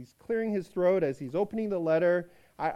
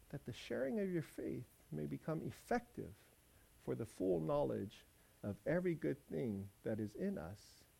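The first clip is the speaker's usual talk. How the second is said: formal letter reading; 2 semitones lower, 6 dB quieter than usual. Acted. Reads the letter aloud.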